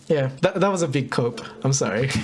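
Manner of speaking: usual speaking voice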